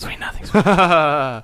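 slow, voiced teasing sweet nothings. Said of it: "I can hear you".

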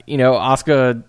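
ordinary talk, nothing marked

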